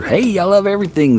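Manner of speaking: put-on high, overly cheerful kids-show voice; super excited style. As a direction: pitch it up like an annoying kids' host. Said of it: "that annoying, you know, super".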